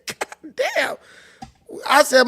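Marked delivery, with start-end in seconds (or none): 0.1-0.9 high, stunned exclamation; stunned god damn. Retold or imitated. Retelling his shock at mom's huge boyfriend, high and stunned. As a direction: react like you just saw something huge.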